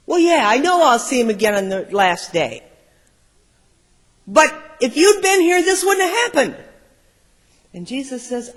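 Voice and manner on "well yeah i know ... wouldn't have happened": high, loud bitter complaint; the sister insisting with rising anger; raised accusation. Acted. Sister answering Jesus, high and loud in anger.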